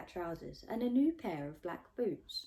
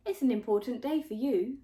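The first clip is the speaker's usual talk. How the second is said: cheery nanny; 6 semitones higher, 8 dB louder than usual. Acted.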